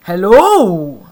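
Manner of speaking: loud bright shout of hello; tortoise shouting hello at Hank; shouted greeting. Acted. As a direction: call out hello across the yard. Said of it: "shouted a tortoise".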